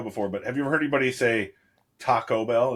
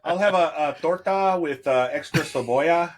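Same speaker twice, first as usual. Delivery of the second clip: put-on a touch of Spanish accent on cebolla; mock Spanish accent; 4 semitones higher than usual. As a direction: order the torta with a light Spanish accent. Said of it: "I do that all the time".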